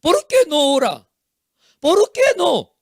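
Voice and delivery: loud, high-pitched parental scolding; blaming parent. Acted. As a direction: demand answers as an accusing parent. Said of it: "no echa culpa a sus hijos".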